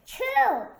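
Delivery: loud, high explosive achoo; big sneeze. Acted. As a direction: let a huge sneeze burst out. Gerald sneezes loudly.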